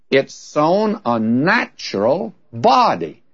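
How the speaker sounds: slow, deliberate, with "body" set apart and stressed. Retold or imitated. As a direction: give the noun "body" its own full weight. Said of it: "put the emphasis on the adjective and not the noun".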